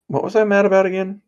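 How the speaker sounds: high, quick innocent question; lightly acted high-pitched puzzlement; suddenly calmed, puzzled. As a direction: ask it high and fast, all anger forgotten. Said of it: "it would calm Cammy right down".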